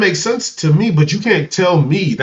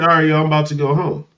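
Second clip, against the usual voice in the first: rattled off quickly, x1.7 pace; fast casual sign-off; much faster than usual. Retold or imitated. Leaving a friend's house, says goodbye fast. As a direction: blurt the goodbye in one breath. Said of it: "I say".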